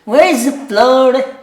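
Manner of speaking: high, loud, slow Dublin repeat; thick Dublin accent, slow and high; Dublin woman's accent. Retold or imitated. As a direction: drawl it like the Dublin customer. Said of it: "She goes".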